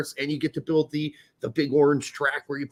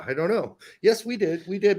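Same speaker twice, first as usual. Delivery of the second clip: usual speaking voice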